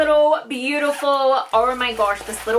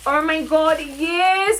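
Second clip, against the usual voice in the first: excited; 5 semitones higher, 4 dB louder, much slower than usual. Real, not acted. Thrilled at wanted palette.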